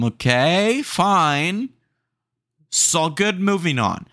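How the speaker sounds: playful East Coast Italian tough-guy voice; East Coast Italian accent. Pretend. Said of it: "Get a little bit East Coast Italian on 'em".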